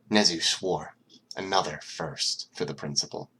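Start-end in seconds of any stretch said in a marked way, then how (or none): none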